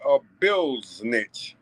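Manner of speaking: "bill snitch" said very slowly, stretched out; slang pronunciation. Shown as a demonstration. A vlogger repeats his slang insult, "bill snitch" stretched slowly. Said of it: "if you listen to how I said it".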